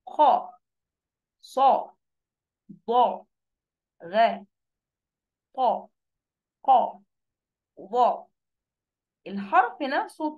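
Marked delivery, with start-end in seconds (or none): 0.1-8.3 each of خاء صاء ضاء غاء طاء قاف ظاء heavy and separate; full emphatic letters. Shown as a demonstration. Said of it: "بصوا حتى إزاي بقولها".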